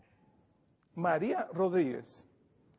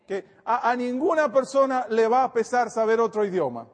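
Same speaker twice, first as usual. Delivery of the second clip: rising, louder sermon push; fervent preaching; 4 semitones higher, 5 dB louder than usual. Acted. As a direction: preach it with conviction to the flock. Pastor exhorting the parents.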